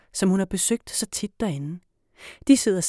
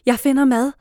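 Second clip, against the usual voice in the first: loud, high call; shout; 7 semitones higher, 8 dB louder than usual. A narrator's reading. Girl running to kitchen, loud shout. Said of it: "råber Esma".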